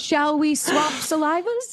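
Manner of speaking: high, slow mock medieval English accent; middle english. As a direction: ham up a medieval English courtier. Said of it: "fake medieval accent".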